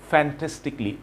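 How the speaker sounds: "fantastically" with "cally" squeezed to "klee"; correct pronunciation. Shown as a demonstration. Teacher corrects learners, correct pronunciation. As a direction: say "fantastically" as "fan-tes-tik-lee". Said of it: "So it should be".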